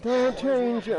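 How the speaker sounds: high, loud mock plea; imitated scream. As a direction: mimic a screamed protest, high-pitched. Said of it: "I heard some screaming".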